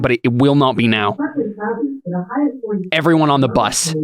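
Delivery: measured, with long pauses between phrases; halting but firm command; firm in-character order. Acted. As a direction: lay down the law, phrase by phrase. In-character order ending an argument.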